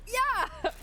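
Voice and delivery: very high, gleeful yell-along; excited cheer. Retold or imitated. Tourists answering their new best friend, excited cheer. Retold delighted group reply.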